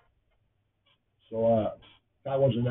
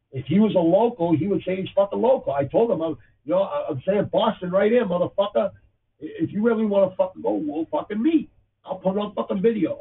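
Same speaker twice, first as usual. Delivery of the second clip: higher, louder, fired-up threat to a heckler; heated challenge; 6 semitones higher, 4 dB louder than usual. Real, not acted. Challenging a podcast-room troll to meet up, heated and loud. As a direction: square up and call him out, voice raised.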